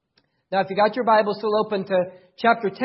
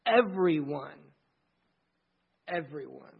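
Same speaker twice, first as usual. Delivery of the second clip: fading emphasis; 6 semitones lower, 6 dB quieter than usual. Acted. Repeating the key word.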